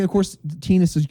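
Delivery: ordinary talk, nothing marked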